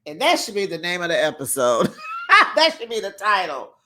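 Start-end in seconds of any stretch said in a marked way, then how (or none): 2.0-2.5 sudden high real laugh; a quick high laugh at her own joke; high burst of laughter. Real, not acted.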